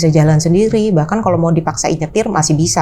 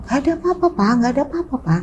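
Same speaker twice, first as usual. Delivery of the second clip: softer, calming the father; soft soothing; 6 semitones higher, 5 dB quieter, faster than usual. Retold or imitated. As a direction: quietly reassure the father. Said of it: "soothing father".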